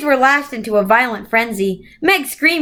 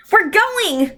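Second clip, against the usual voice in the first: put-on excited child; 10 semitones higher than usual. A narrator's reading. Charles Wallace announces.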